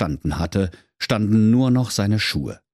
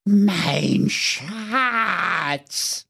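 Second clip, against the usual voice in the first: Gollum-like whimper; 9 semitones higher than usual. Acted. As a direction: plead for the precious. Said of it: "rief er nur ganz leise".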